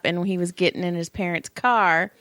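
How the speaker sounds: normal talking voice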